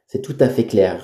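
ordinary delivery

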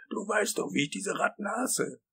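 high, whiny protest; aggrieved, high-pitched wail; plaintive complaint. Acted. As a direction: whine pleadingly, pitch climbing. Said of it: "klagte Wisconski an Hal gewandt".

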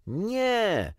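very high, drawn-out no; chorused refusal. A narrator's reading. All the children turning down Świstek's trick, chorused refusal. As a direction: draw out a high-pitched no. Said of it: "odpowiedzieli wszyscy".

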